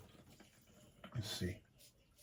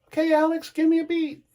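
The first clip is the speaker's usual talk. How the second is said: imitating stage call. Singer calls to drummer onstage.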